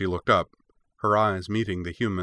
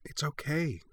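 soft, high soothing voice; gentle reassurance; 8 semitones higher, 8 dB quieter than usual. A narrator's reading. Cora soothes the edging puppy, gently reassuring. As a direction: reassure softly, well above your usual pitch.

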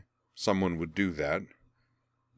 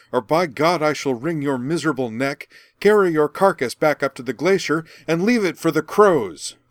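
loud, higher raging scolding; furious tirade; 8 semitones higher, 8 dB louder than usual. Acted. Merwin berates the ungrateful patient, loud furious rage. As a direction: tear into her with outrage. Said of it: "the furious redhead's voice".

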